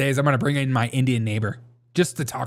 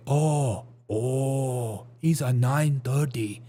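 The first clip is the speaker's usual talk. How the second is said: slow imitated Korean accent; mock Korean accent; much slower than usual. Korean announcing the time, slow mock Korean accent. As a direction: stretch it out in a put-on Korean accent. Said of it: "Koreans, like".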